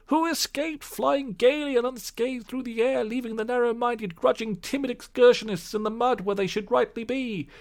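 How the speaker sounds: high, gleeful boasting Toad; self-admiring gloat. Acted. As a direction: preen and brag. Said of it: "in ecstasies of self-admiration".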